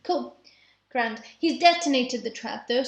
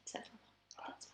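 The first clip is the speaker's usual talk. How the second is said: whispering; 24 dB quieter than usual. Real, not acted. Secretive aside in card game.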